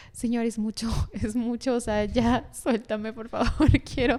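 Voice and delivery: giggling as she begs for relief; a breathless, laughing retold plea. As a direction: laugh through the pleading to God. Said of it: "yo decía".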